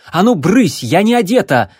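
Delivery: loud, high shout: get out!. Acted. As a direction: yell it out in a panic. Abby shoos Remi, undressed.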